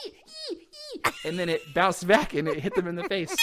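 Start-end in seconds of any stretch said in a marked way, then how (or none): 0.1-1.0 short high eee squeaks; imitated bat squeaks. Host imitating bats flying through a cave, tiny squeaks. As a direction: squeak like a bat sending sonar. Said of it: "they went".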